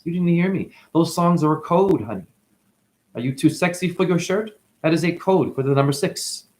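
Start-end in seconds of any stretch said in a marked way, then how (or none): none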